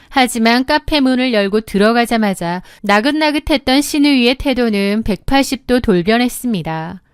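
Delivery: usual speaking voice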